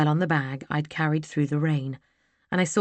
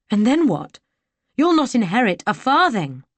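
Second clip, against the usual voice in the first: angry outburst; 6 semitones higher, 7 dB louder than usual. Acted. Heroine confronts villain.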